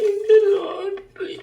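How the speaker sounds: put-on a child howling over his lost lottery; crying. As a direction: wail it out, slowly. ഒന്നും കരയരുത് ട്ടോ.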